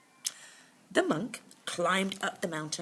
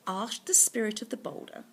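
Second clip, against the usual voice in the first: usual speaking voice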